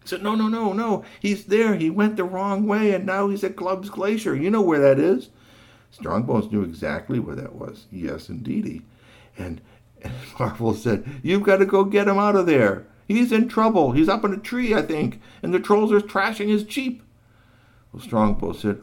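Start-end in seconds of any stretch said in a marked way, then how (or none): 0.2-5.3 much higher, louder insisting; insistent. Acted. Marvel insisting to Strongbones, high and loud. 11.2-17.0 much higher, louder urging; urgent. Acted. Marvel urging Strongbones to rescue GP, high and loud.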